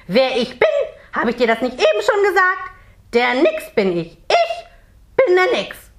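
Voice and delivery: high, loud indignant little creature voice; angry. A narrator's reading. Merman introduces himself to Jonathan, angry. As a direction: announce yourself with outraged self-importance, pitch leaping up. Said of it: "sagt der kleine Mann böse".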